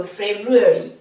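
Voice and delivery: ফেব্রুয়ারি as feb-roo-ery, low and falling; ফেব্রুয়ারি with a short, light ending; English pronunciation. Shown as a demonstration. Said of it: "ইংলিশে আমরা এইটাই বলবো".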